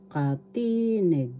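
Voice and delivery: "katinig" with a long, stressed ti; stress on second syllable. Shown as a demonstration. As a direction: slowly stretch the middle ti of "katinig". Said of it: "The accent is in the second syllable".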